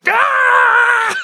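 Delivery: sudden high loud mock shriek; mock scream. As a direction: yelp like doused with holy water. Doused with holy water.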